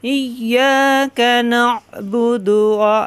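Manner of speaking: slow melodic chanted recitation of al-Fatiha; Quran recitation. Shown as a demonstration. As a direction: chant the whole surah with long drawn vowels. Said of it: "আমরা আরেকবার পড়ি।".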